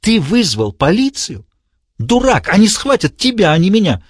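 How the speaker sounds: high, incredulous madman voice; mock him as a fool; shocked villain. A narrator's reading.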